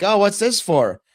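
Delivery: high, loud imitated inspector; questioning inspector. Acts out inspector finding the powder.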